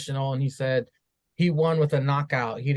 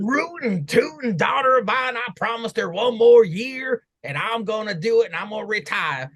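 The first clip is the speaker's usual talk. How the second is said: exaggerated mock Southern vow, pitched up; Southern accent; 7 semitones higher than usual. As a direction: play up a rootin'-tootin' Southern accent. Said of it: "gave him a Southern accent".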